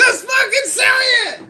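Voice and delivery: very high, loud scream; exasperated full-voiced yell; angry shouting. Real, not acted. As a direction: scream it impatiently. Yelled demand to say it.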